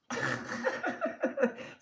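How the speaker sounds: high breathy laughing fit; real laughter at her own joke. Real, not acted.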